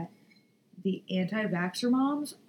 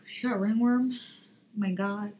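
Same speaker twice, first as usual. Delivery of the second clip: usual speaking voice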